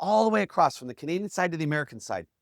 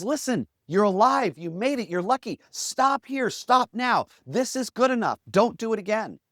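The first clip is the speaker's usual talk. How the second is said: pleading, raised voice in short bursts; urgent dissuading; 6 semitones higher, 3 dB louder than usual. Retold or imitated. Reporter talking Harry out of going back, urgent dissuading. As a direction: talk someone out of it, higher and insistent. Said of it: "trying to talk Harry out".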